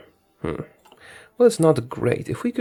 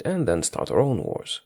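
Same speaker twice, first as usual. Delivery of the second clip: ordinary talk, nothing marked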